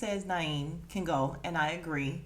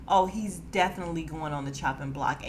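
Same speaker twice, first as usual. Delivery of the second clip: normal talking voice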